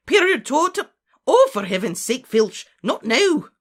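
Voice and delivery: high, very loud outburst; commanding voice turned exasperated; exasperated shout. Acted. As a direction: cry out, then scold in exasperation. Spell broken off in exasperation.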